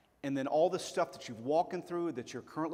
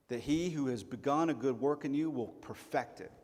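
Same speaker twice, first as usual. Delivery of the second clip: ordinary delivery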